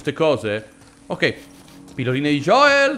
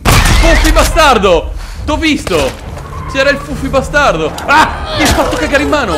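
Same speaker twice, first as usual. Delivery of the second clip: loud high shocked shout; startled yell; 10 semitones higher, 9 dB louder than usual. Real, not acted. Gamer shouting after a dog jump scare, startled.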